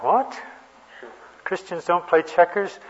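high, rising retold disbelief; incredulous question. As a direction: echo it back as a baffled question. Retold astonished reaction to odd rule.

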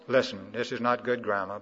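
normal talking voice

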